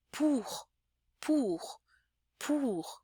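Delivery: "pour" with a puff of air on the p; breathy aspirated p in "pour"; soft repeated drill; aspirated French P. Shown as a demonstration.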